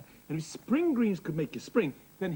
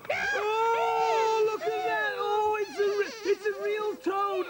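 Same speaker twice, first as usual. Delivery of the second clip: high squealing surprise, slowed on the last words; much higher, shrieked disgust; shocked; 11 semitones higher, slower than usual. Acted. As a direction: recoil at the toad in the dish. Discovering a real toad.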